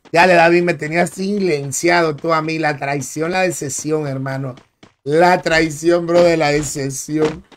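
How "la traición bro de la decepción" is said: slow, drawn-out mock tragedy; milk the betrayal theatrically; hammed-up lament, slowed right down; mock dramatic, slow. Shown as a demonstration. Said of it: "repeated slowly, dramatized".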